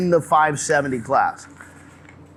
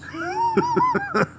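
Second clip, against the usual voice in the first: laugh; 3 dB quieter than usual. Real, not acted. Laughs at co-host's joke.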